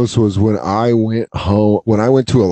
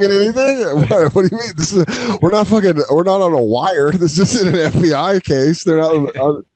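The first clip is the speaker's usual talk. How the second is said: mock defensive; 7 semitones higher than usual. Real, not acted.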